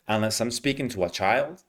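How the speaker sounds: ordinary delivery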